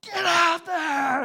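sudden high, loud bellowed order; skipper's scream. Retold or imitated. Storm-tossed skipper screams at the welder, high loud bellow. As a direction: yell it over the storm like a desperate captain. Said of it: "skipper screams at me".

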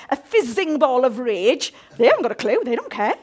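ordinary talk

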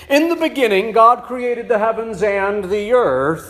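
grand scripture reading voice; booming biblical narration. Acted. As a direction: open like Genesis itself. Genesis opening read grandly.

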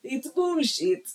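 put-on slow, drawn-out Eastern European accent. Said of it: "it comes out in a vaguely Eastern European accent".

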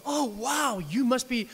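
high, loud mock wonder; mock amazement. Preacher mocks a stunned listener's amazement. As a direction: gush with fake awe.

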